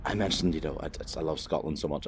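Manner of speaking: ordinary delivery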